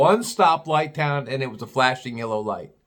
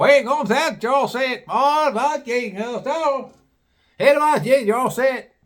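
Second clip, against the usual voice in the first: put-on high, garbled good-old-boy holler; incomprehensible local; 10 semitones higher, 4 dB louder, slower than usual. Garage man sending customers inside, high mock-local holler. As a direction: holler it high, words mashed together. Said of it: "the guy tips his head and goes".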